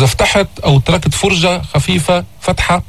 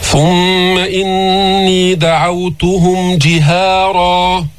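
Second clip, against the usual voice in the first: slow, measured tajweed recitation; steady melodic Quranic chant; 4 semitones higher, much slower than usual. Shown as a demonstration. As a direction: chant the verse with long, held vowels.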